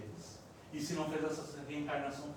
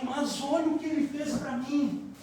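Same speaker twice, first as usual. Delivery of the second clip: aggrieved protest; 8 semitones higher, 5 dB louder than usual. Retold or imitated. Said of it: "E ele diz assim".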